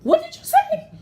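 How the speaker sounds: shocked and affronted; woman confronting the cart thief, outraged disbelief. Retold or imitated. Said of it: "I went".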